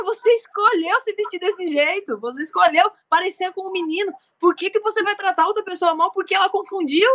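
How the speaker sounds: ordinary talk, nothing marked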